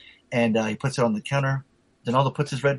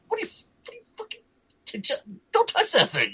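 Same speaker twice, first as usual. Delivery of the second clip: high, stammering mock exasperation; splutter, then tell him off; squeaked-up, flustered retold scolding. Retold exasperation at friend touching statue.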